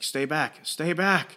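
half-hearted panicky warning; imitated alarm, pitch rising; mock freak-out. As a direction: act out backing away in alarm. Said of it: "she starts freaking out".

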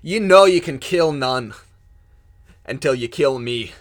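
loud, firm challenge to the creature; defiant challenge. Acted. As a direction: stand your ground and shout it. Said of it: "points his shovel at this creature and says".

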